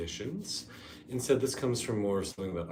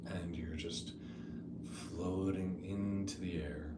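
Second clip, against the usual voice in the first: soft, unhurried meditation voice with long pauses; guided meditation; 7 dB quieter than usual. Real, not acted. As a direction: ease them gently into the imagery.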